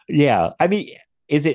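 ordinary delivery

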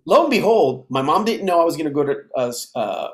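ordinary delivery, nothing marked

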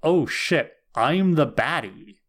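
imitated stunned realization; dawning realization. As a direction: act out the penny dropping, stretching the last words. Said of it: "it hasn't sunk in for him until this moment".

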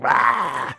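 short mock roar; imitated tongue-out roar. Showing Castor Troy's wild tongue thing, mock roar. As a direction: roar it like a hammy villain. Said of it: "with the tongue and everything like that".

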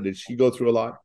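ordinary talk, nothing marked

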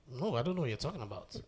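soft, quick feigned ignorance; understated, dismissive denial; quiet denial. Retold or imitated. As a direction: imitate someone brushing it off quietly. Retold Putin denying everything.

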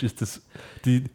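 ordinary talk, nothing marked

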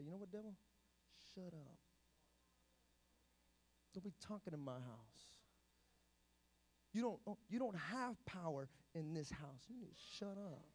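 very soft, low, firm telling-off; quiet rebuke. Shown as a demonstration. Believer rebuking the devil at home, hushed and firm. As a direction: quietly lay down the house rules. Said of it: "you just gotta say".

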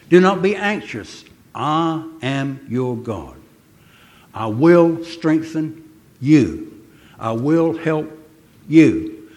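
slow, weighty scripture reading with every word stressed. Shown as a demonstration. Preacher slowly reading Isaiah to the congregation. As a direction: read it slowly, leaning on each promise. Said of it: "I'm gonna read this very slowly".

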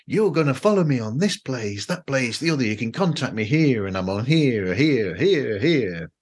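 mock American hype with singsong swoops; excited Americans. Podcaster mocking Americans' self-promotion, all excitement. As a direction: imitate an over-excited plugger, each "here" rising and falling. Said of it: "Americans are all excitement".

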